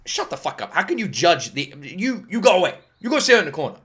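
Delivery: high, loud, hammed-up telling-off; mock outraged scolding. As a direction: scold them in exaggerated indignation. Mock telling-off of shallow critics.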